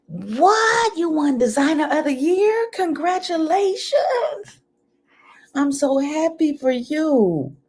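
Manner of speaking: high gasping what, then excited congratulations; thrilled shock at a friend's big news; surprised delight. Real, not acted. Hearing a friend won designer of the year, gasping with surprise.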